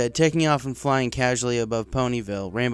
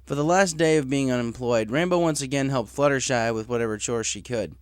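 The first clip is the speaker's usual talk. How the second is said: ordinary delivery, nothing marked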